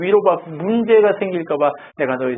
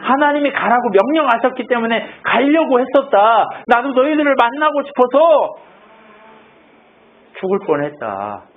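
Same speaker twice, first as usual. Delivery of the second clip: lifted, emphatic pulpit voice a bit higher; raised preaching; 5 semitones higher than usual. Acted. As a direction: preach it out to the congregation. Pastor preaching Paul's journey.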